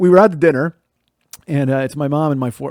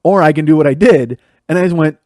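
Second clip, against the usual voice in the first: ordinary talk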